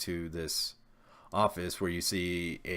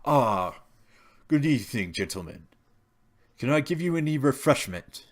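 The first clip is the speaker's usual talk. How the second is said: polite butler; 6 semitones higher, 5 dB louder, faster than usual. Acted.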